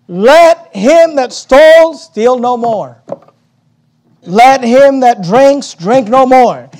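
slow, loud, emphatic preaching; preaching scripture. Acted. Preacher thundering scripture to the congregation, slow and loud. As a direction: drive each line home slowly.

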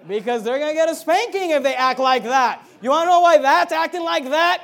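loud, high, driving preacher's delivery; preaching at full force. Real, not acted. Raise the voice and hammer it at the congregation.

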